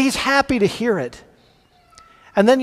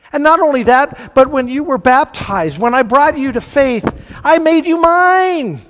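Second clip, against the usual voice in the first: building fervor; 6 semitones higher, 4 dB louder than usual. Acted. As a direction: swell to a triumphant claim. God voiced, climax of sermon.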